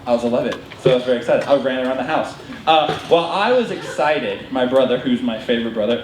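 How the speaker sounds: ordinary delivery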